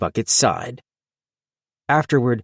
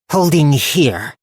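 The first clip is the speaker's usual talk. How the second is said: slow, higher goblin voice; terse little goblin order; 6 semitones higher, 4 dB louder, slower than usual. Acted. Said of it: "said Zil".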